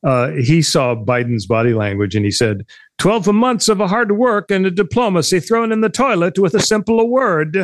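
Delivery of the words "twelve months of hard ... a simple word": lightly put-on Italian accent, higher; a cheesy Italian diplomat voice, just a hint; Italian accent impression. Quoting an Italian diplomat to the co-host in a light Italian accent. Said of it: "my Italian accent impression".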